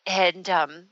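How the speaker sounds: ordinary talk, nothing marked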